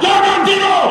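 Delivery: high-pitched call to sleepers; suara lantang. Shown as a demonstration. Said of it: "memang lantang. Kan, suara saya kuat". (seru lantang dengan nada tinggi)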